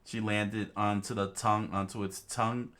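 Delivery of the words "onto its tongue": ordinary delivery, nothing marked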